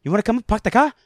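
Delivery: high, loud Boston-accented impression; Mark Wahlberg. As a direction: do a hammed-up, high-pitched Boston guy. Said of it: "they're doing funny Mark Wahlberg impressions".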